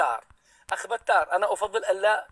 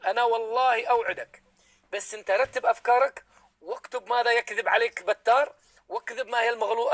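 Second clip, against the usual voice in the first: normal talking voice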